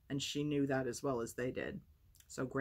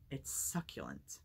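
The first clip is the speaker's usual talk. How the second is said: suggestive; 9 semitones lower, 6 dB quieter, slower than usual. Acted. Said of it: "James suggested".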